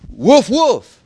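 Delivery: high, loud playful woof; woman barking back at the insult; mock barking. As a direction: bark like a dog, mocking. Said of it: "you want to call me a dog".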